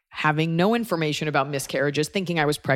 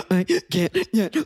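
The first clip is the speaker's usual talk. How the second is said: a hint of sobbing, pitched up; mock breakup crying; 4 semitones higher than usual. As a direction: imitate crying too hard to talk. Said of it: "breakup crying".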